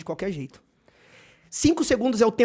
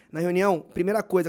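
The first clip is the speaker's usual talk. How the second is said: normal talking voice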